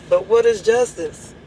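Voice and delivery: ordinary talk, nothing marked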